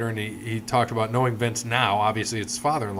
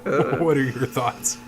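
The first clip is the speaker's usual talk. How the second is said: cracking up while reading the question; laughing; 3 semitones higher than usual. Real, not acted. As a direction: struggle to ask it through laughter.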